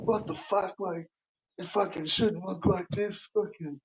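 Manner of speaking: high-pitched mock complaint from a bloke; imitating annoyed dude. Dude complaining about cocktail.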